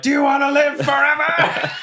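very high-pitched hollered challenge; rally the troops with a high yell; rallying battle cry. Acted. Rallying call to the others.

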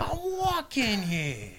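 high, stretched-out mock holler at normal volume; hammed-up high-pitched protest; New York catchphrase yell. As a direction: draw it out slowly like mock pedestrian outrage. Repeats the catchphrase at cab drivers.

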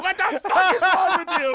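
very high, loud scolding in imitation; mock outraged wife. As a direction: play the appalled wife, half laughing. Wife reacts to the prank.

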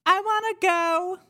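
very high little ghost squeak; small, high-pitched ghostly peep; tiny ghost voice. Acted. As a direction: pipe it up in a teeny spirit voice. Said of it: "a real tiny little voice".

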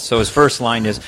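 ordinary delivery

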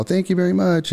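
high polite thank you; polite woman. Retold or imitated. Woman thanks the stranger at her door, high and polite.

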